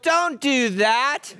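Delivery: put-on slow, drawn-out parent warning to a small child; the way you'd tell a three-year-old no; scolding a toddler. Talking down to a three-year-old, slow scolding parent voice. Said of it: "Like you would tell your three-year-old".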